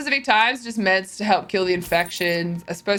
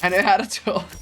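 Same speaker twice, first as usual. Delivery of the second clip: replaying own quote; 3 dB louder than usual. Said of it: "That clip is a lie".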